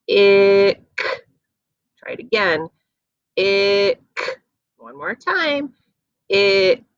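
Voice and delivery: put-on teacher stretches the chunk for kids; stretched chunk. Shown as a demonstration. Teacher modeling the ick chunk. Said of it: "let's stretch through this chunk".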